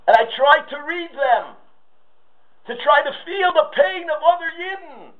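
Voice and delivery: high, shouted sermon climax; fiery preaching. Acted. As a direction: preach it out with full fervor. Preaches at full force.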